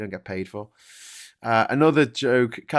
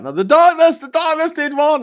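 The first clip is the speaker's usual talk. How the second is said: much higher and louder, hammed-up; mock shout; 12 dB louder than usual. Reading a chat viewer's shouted correction, mock shouting. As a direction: yell it like an eager heckler. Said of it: "someone shouts out in the chat".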